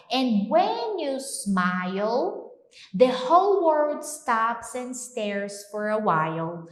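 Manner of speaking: slow, spoken lyric; slow song lyric. Shown as a demonstration. Teacher reciting a love song to students, slow. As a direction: savour the lyric slowly. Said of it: "Ele continua".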